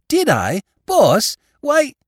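blurting out, eager to tell; excited Spike. Acted. Spike bursting to tell his boss, excited.

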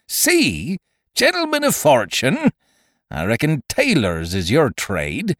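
disgusted pirate captain, leaping high on incredulous questions; Silver. Acted. Captain berating his crew as no seamen, disgusted. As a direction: scold them with withering scorn.

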